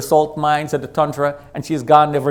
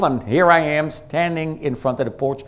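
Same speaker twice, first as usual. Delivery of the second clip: ordinary delivery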